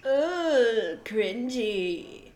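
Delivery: jingle-like "Ooh, cringy"; sound effect voice. Acted. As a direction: say it like a radio sound grab. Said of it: "thank you for the sound effect".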